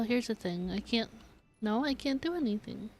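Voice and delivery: ordinary talk, nothing marked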